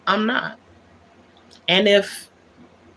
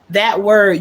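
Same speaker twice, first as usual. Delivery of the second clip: usual speaking voice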